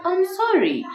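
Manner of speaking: high, sweet pleading sorry; coaxing, high-pitched apology to a husband; tender wifely apology. Acted. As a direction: melt his heart with a sweet apology. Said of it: "that voice alone melts his heart down".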